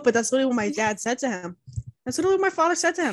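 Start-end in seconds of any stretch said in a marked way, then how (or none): 2.1-3.1 a rushed, mock-formal repeat; mock posh retelling. Retelling dad's words to friend, rushed mock voice. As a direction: rattle it off in a put-on voice.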